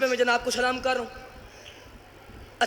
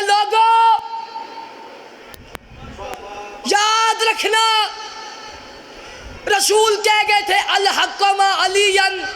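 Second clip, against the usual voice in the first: loud, high cry with drawn-out words; public proclamation; 6 semitones higher, 4 dB louder, much slower than usual. Acted. Old servant calling out to the people in the square, public proclamation. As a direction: proclaim it slowly across a whole square.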